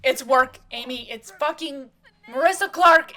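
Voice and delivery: huffing, screaming. Acted. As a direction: blurt it out at full volume, giving up. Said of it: "screaming at each other as he just huffs out".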